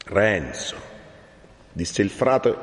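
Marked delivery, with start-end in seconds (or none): 0.0-0.9 low, restrained rebuke; stern warning. A narrator's reading. Friar seizes Renzo by the arm, stern. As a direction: say the name lower, as a check. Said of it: "guardandolo ancor più severamente".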